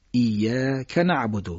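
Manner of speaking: ایاک held on long ya, short ka joined to نعبد; short joined vowel. Shown as a demonstration. Teacher modelling correct recitation, joined syllables. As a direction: slowly lean on iyya, then kana'budu in ایاک نعبد. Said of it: "که رو با نعبدو بگید".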